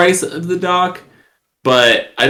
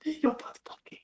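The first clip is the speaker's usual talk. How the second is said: whispered aside; hushed, breathy aside to the table; 9 semitones higher, 17 dB quieter, much faster than usual. Real, not acted.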